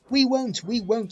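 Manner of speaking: mock screaming trucks; screaming refusal. A narrator's reading. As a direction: shriek it like defiant trucks. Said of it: "screamed the trucks".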